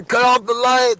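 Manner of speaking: woken sleeper. Acted. Acted quote of woken sleeper.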